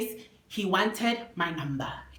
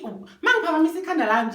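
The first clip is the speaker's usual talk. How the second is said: ordinary delivery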